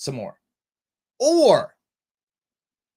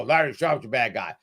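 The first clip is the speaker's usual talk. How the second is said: mock voter; 6 semitones lower than usual. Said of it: "And you go".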